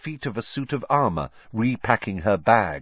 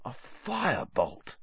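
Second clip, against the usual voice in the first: hushed, higher murmur of wonder; soft and higher, lost in wonder; awed; 4 semitones higher, 7 dB quieter than usual. A narrator's reading. Wood marvels at the broom again.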